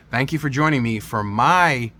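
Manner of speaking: ordinary delivery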